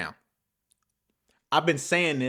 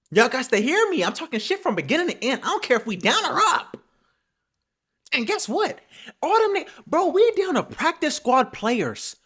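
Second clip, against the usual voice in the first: worked-up rant; 10 semitones higher, 3 dB louder than usual. Real, not acted. Ranting at rival fans about underdogs.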